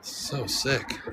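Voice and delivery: ordinary talk, nothing marked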